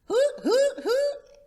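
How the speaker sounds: high, loud hooting call; a boy calling like a bird. Acted. Child hooting running.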